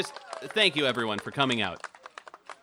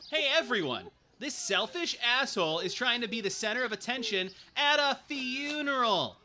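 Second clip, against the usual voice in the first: calling out; 10 semitones higher than usual. Acted. Calling out a guest at funeral.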